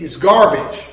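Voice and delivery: ordinary talk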